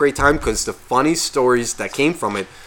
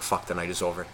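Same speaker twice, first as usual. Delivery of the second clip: resigned; 5 semitones lower, 8 dB quieter than usual. Retold or imitated. Resigned to night being over.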